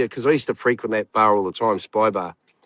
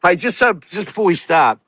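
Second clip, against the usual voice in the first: higher-pitched call to a crowd; retold urgent interjection; 8 semitones higher than usual. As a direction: jump in to clear things up. Retells jumping up to address crowd.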